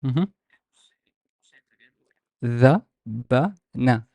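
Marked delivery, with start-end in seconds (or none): 2.4-4.0 Dhabana very slowly, as three separate syllables. Shown as a demonstration. Teacher gives every consonant time, Dhabana slow and separated. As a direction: break Dhabana into dha, ba, na, pausing between. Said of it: "Slowly. Give every consonant a time".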